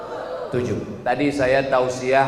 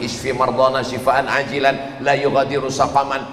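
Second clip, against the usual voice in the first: lower, steady healing prayer in Arabic; a calmer, lower Arabic prayer; low recited prayer; 6 semitones lower than usual. Shown as a demonstration. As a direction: recite a sick-visit doa calmly. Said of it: "saya baca doa".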